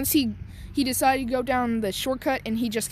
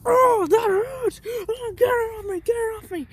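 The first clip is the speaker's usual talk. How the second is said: very high, loud, pained squeal; 13 semitones higher, 4 dB louder than usual. Retold or imitated. Bakugo kicked by the robot, squealing in pain. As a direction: whine like you're being kicked. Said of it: "Bakugo was like".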